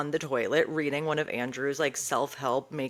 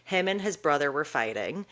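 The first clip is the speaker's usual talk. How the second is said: usual speaking voice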